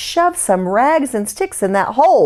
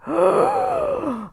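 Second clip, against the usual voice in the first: terrified gasp; 4 dB quieter than usual. Acted.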